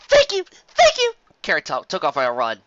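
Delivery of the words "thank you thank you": high, hurried grateful pony; breathless thanks. Acted. Carrot Top thanks the guide before dashing off, high. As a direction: thank him while already running.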